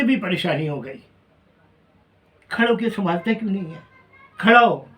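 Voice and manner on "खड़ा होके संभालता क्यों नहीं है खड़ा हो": louder, higher scolding, the last words shouted; scolding mother. Retold or imitated. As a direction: snap at him to stand up on his own. Mother scolding the grown son. (ऊँची, डाँटती हुई माँ की आवाज़)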